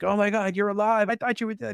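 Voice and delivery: a light imitation of a shaken friend crying out; retold frantic yell. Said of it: "he's screaming and he's yelling".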